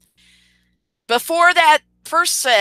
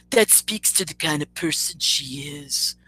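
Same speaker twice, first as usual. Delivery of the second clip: low, softer mock man's voice; imitating his voice; 6 semitones lower, 6 dB quieter than usual. Streamer mimics rival judging her, low put-on voice. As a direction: sink low to mimic him passing judgment. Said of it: "let me get his voice down, guys".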